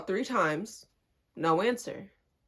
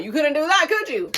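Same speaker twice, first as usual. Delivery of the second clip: higher, louder mock challenge; taunting; 6 semitones higher, 5 dB louder than usual. Answering the guy's DM request, taunting. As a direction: rub it in with a taunt.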